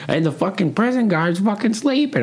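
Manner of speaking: high mock old-man holler; old man yelling across a bar. Old man yelling across the bar at a stranger, high mock holler. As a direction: bellow it like a barfly ranting. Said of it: "just yelling across the bar".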